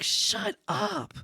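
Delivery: high-pitched mock-furious retort; so mad. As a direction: act out an exasperated outburst, pitched high. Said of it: "I was so mad".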